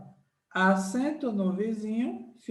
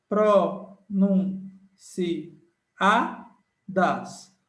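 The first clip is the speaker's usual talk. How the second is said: very slow, louder word split into syllables; 4 dB louder, much slower than usual. Shown as a demonstration. Teacher counting syllables for students, very slow. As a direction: count out each syllable slowly. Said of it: "Uma, duas".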